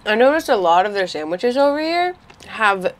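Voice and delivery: muffled speech around food; talking while chewing. Real, not acted. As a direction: hand covering mouth. Seen 0: Hand covers mouth mid-chew while talking, lowered by 3.4.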